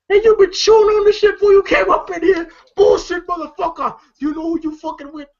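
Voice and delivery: high, loud mock yell at him; retold yell. Daddy yelling at a customer over the CD, a mock yell.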